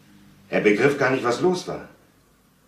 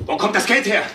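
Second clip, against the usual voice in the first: much higher and louder demand; confronting demand; 11 semitones higher, 3 dB louder than usual. Acted. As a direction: press him hard for the truth. Son confronts drunk father again.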